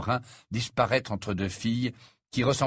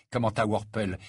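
ordinary delivery, nothing marked

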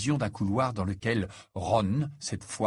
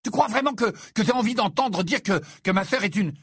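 high, heated stumbling over words; raised-pitch indignant outburst; furious; 9 semitones higher, 3 dB louder than usual. A narrator's reading. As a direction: rant angrily, tripping on the phrase. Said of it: "tout aussi furieux".

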